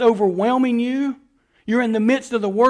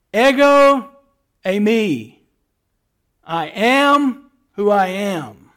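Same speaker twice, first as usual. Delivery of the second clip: booming louder, slow God voice; 6 dB louder, much slower than usual. Acted. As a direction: proclaim it as God from the bush. God answers Moses.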